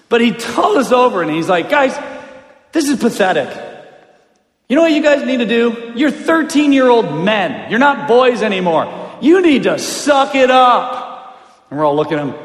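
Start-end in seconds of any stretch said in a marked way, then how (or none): 1.7-11.4 raised, higher, forceful dressing-down; retold coach's scolding. Coach dad to thirteen-year-old losing team, forceful scolding. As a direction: lecture them hard, stretching the last command.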